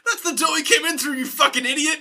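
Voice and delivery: high-pitched mock indignation; exasperated crowd. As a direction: imitate a fed-up group scolding their host. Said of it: "And they're like".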